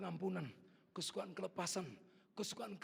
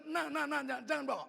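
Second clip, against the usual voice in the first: raised, higher father's voice; urgent, loud call to a child; 11 semitones higher, 9 dB louder than usual. Retold or imitated. Father stopping his son from taking the bike, raised voice.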